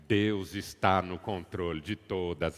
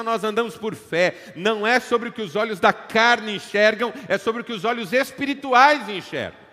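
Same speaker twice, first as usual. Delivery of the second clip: loud, high, emphatic sermon delivery; full-force preaching; 10 semitones higher, 12 dB louder than usual. Acted. Pastor to congregation, loud, high impassioned preaching. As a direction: preach it out to the whole church.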